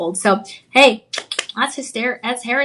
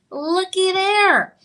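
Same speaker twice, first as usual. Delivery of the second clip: drawn-out delight; 7 semitones higher, much slower than usual. Real, not acted. Showing the gem.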